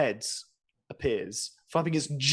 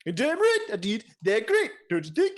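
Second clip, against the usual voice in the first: king voice; 6 semitones higher than usual. Acted. GM voicing the king NPC.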